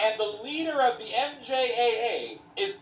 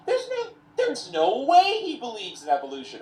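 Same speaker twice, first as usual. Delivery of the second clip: higher-pitched retold incredulity; emphatic disbelief; 4 semitones higher than usual. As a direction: act out her refusal to believe it. Woman's disbelief continues, easing.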